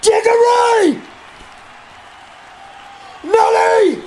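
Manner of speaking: put-on drunk yelling outside. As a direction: holler names across the lot. Calling names out loud.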